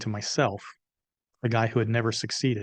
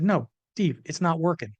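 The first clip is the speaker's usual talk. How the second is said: higher, insistent acted objection; exasperated refusal; 6 semitones higher than usual. To business partner who keeps insisting, exasperated. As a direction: push back hard, pitch raised.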